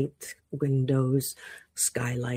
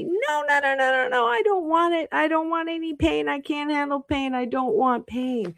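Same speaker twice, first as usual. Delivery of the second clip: much higher, pleading whine; whiny refusal; 12 semitones higher than usual. Acted. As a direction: beg like a scared child to stop. Rock refusing the chisel.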